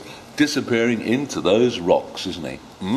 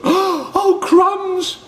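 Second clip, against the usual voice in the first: high, loud startled shout; shocked cry; 5 dB louder than usual. Acted. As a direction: yelp it in shock. Snake shocked.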